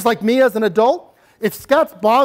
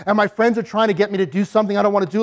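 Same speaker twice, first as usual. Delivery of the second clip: normal talking voice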